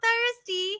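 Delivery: put-on high, drawn-out kid whine; whining kids. Kids complaining while waiting at the parade, imitated whining. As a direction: whine it slowly like a thirsty child. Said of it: "your kids are like".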